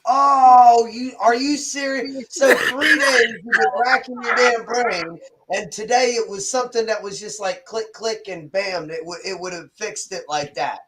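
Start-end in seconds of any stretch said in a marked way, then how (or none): 0.0-5.2 loud drawn-out "oh", then raised pitch; higher, emphatic real disbelief; exasperated disbelief. Real, not acted. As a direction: react with incredulous sympathy. Reacts to friend's three-day coding struggle.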